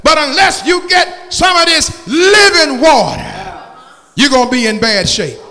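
rising, shouted gospel preaching; fiery preaching. Acted. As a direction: preach it out loud to the church. Preacher at full force.

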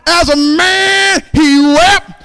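rising shouted whoop on each "But as God", dropping back between; full-force preaching. Acted. Preacher whooping to the congregation about Jesus the winner. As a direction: preach it like a call and response, climbing to a roar.

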